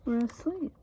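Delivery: ordinary delivery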